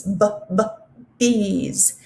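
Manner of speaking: b, b, bees — stretch the b sound then say the word bees; isolated b sound. Shown as a demonstration. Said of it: "you should have said bees".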